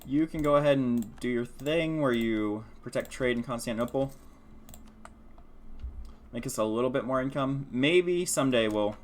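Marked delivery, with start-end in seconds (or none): none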